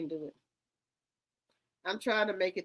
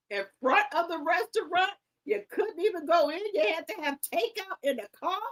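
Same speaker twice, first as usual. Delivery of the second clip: higher, louder exasperated telling-off; incredulous scolding; 6 semitones higher, 5 dB louder than usual. Real, not acted. Scolding the woman on screen, high and loud incredulous. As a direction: scold her like a friend who should have known.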